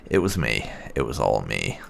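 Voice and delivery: usual speaking voice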